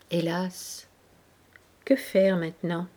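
plainte soupirée, plus douce; soupir résigné. A narrator's reading. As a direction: soupire ces mots avec résignation. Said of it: "Marescot soupira". (en soupirant, résigné)

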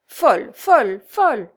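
loud, cross, hammered repeats; grumbling repetition. A narrator's reading. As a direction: punch out each repeat in exasperation. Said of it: "en grommelant et en répétant".

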